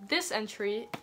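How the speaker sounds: ordinary talk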